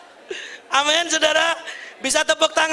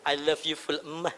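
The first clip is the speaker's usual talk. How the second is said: very soft, low loving thanks; tender; 9 semitones lower, 10 dB quieter than usual. Pretend. Husband thanking wife for coffee, very soft and low. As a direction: murmur it tenderly to your wife. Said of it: "belajar bilang begini".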